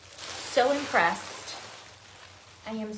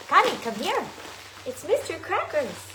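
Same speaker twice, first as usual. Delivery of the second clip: higher and louder, eager call; excited calling out; 6 semitones higher, 7 dB louder than usual. Real, not acted. Thrilled unboxer calls Connie over to see the nutcracker, excited.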